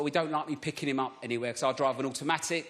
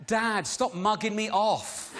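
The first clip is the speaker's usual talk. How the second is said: put-on slow, higher-pitched posh child; 5 semitones higher, much slower than usual. Child telling Dad off in a mimicked posh accent. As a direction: imitate a posh kid drawing out Dad's slang. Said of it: "in a posh voice".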